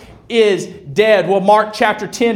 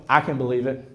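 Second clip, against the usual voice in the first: low, soft, matter-of-fact reply; understated pastor's reply; calm belief; 7 semitones lower, 7 dB quieter, faster than usual. Acted. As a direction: say it quietly and low, unsurprised. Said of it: "And I'm gonna go".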